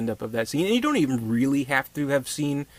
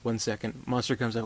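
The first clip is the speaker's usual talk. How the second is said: ordinary talk, nothing marked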